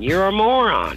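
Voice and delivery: high, loud punchline jab; teasing Irish gentleman. Retold or imitated. Gentleman in County Cork telling Ann she's a moron, teasing. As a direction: land the insult as a dry joke. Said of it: "And he said".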